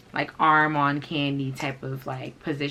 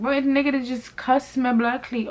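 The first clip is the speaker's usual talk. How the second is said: higher-pitched disbelief; indignant retort; 9 semitones higher, 4 dB louder than usual. Retold or imitated. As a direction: fire back, scandalized at being cussed out. Indignant retort to her friend.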